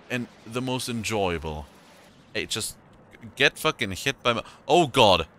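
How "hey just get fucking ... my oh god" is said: loud, frantic combat yelling; panicking mid-fight, shouting. Real, not acted.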